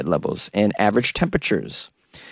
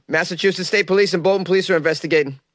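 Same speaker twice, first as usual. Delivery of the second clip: ordinary delivery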